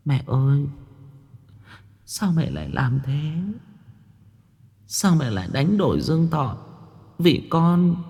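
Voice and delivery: put-on high, soft child's voice; faint high-pitched child pleading with mother; weak, faint gasp. A narrator's reading. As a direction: gasp it out feebly like an ailing little girl. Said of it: "Con bé thều thào".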